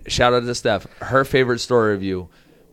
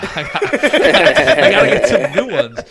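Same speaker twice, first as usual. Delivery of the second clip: high, loud words bursting through laughter; laughing retelling; 13 semitones higher, 7 dB louder than usual. Retold or imitated. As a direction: crack up while quoting the excuse. Retelling absurd excuse while laughing.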